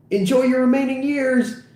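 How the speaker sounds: high-pitched cheery send-off; gleeful farewell. Acted. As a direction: call a hammed-up goodbye. Fantasy farewell to old lady.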